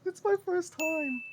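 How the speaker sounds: high, slow squeaky little voice. Acted. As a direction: squeak it out nervously. Squeaky put-on voice, nervous.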